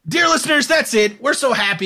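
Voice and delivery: high, loud, sing-song address to the listeners; mock host voice. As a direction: ham up a cheesy radio host greeting. Mock sign-off to the audience.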